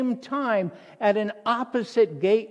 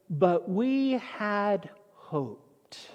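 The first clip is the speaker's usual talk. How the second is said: drawn out slowly, softly rueful; slow rueful quote; 3 dB quieter, much slower than usual. Acted. Preacher to congregation, slow rueful echo. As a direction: let the hope fall away slowly.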